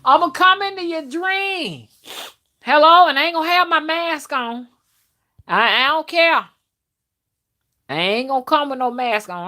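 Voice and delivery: high, loud mock menace; teasing threat. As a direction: playfully threaten to haunt them.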